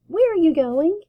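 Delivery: little sister calling out eagerly. Acted. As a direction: call after her big brother. Little sister calling after brother.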